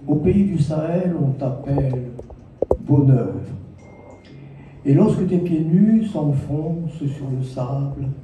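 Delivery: measured verse with long pauses between phrases; poetry recital. Acted. Poet reciting verses for a child of the Sahel, poetry recital. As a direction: recite lyrically, lingering on the line endings.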